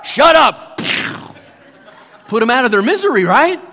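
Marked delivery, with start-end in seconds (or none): 0.0-0.8 high, loud snap to silence it; exasperated mock yell; annoyed shout. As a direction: shout down the noisy bird.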